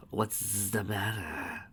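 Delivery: slow tender whisper; hushed motherly concern; soft whisper. Acted. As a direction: comfort a crying daughter.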